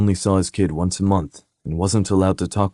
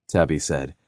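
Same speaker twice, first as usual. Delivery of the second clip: usual speaking voice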